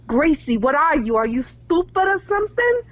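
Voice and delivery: imitating a brassy Boston Italian boss; mock Boston accent, rising high at the end. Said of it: "Let's hear your Boston one".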